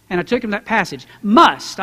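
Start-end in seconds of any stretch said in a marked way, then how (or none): 1.2-1.8 must punched loud and alone; stress on must. Shown as a demonstration. Preacher to congregation, stressing Must. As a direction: hit the single word Must hard. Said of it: "how you read that word must".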